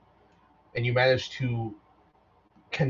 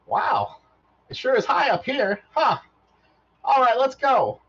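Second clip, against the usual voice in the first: high, loud delighted robot; a thrilled little robot on a shoulder; 12 semitones higher, 5 dB louder, slower than usual. Acted. Robot riding on Vaughn's shoulder, high and excited. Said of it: "and he goes".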